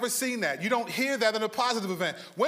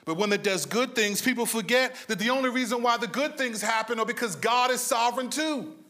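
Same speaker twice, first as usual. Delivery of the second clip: ordinary talk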